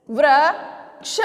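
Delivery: slow sounding out. Shown as a demonstration. Teacher sounding out वृक्ष slowly for children. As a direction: say वृक्ष very slowly, drawing out वृ before क्ष. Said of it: "अब इससे क्या शब्द बनाए मैंने?".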